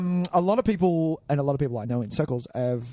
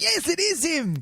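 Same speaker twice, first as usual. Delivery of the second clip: excited fan shout; 14 semitones higher than usual. Retold or imitated. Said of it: "they're screaming down there like".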